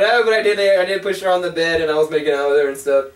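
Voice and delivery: normal talking voice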